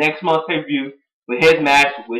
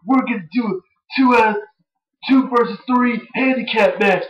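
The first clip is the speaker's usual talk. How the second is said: higher, lightly voiced mock announcement; announcing boss; 6 semitones higher than usual. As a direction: sell the match like a showy GM. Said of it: "he's like".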